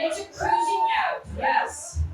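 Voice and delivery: very high, loud held shout; hyped-up shout. Acted. Comic announcing a cruising app to the crowd, high excited shout. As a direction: whoop it out at the top of your range.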